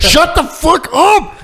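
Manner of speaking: fed up, pitch shoots up; exasperated outburst. Real, not acted. Griping to a friend about the movie, loud exasperation. Ranting at bad movie dialogue.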